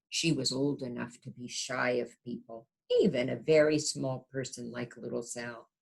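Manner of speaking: gentle storybook narration; reading to children. A narrator's reading. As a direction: read it like a bedtime picture book. Reads picture book aloud.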